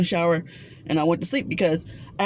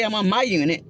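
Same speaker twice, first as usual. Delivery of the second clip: ordinary talk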